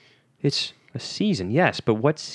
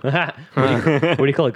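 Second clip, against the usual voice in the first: ordinary delivery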